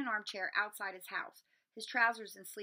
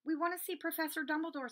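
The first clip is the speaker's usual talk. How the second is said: brave; 8 semitones higher than usual. A narrator's reading. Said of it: "rather bravely".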